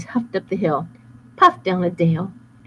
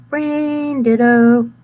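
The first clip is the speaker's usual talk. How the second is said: high loud goofy voice; dim wolf; 5 semitones higher, 6 dB louder, much slower than usual. Acted. Bad boy agreeing with partner, high goofy. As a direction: blurt it out dopey.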